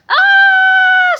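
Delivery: very high, very loud scream; terrified scream. A narrator's reading. Melvin fleeing the mob, screaming. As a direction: shriek in panic. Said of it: "screamed Melvin".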